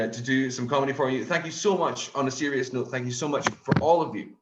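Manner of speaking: ordinary talk, nothing marked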